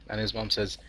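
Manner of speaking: normal talking voice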